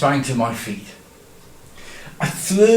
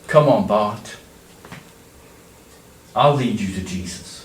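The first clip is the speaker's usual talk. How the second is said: gentle little voice; 6 semitones lower than usual. Acted. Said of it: "a gentle little voice said".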